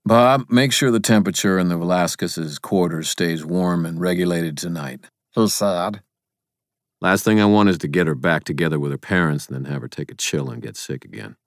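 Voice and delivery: brisk, weary instruction; lightly voiced weary wizard; Harry giving orders.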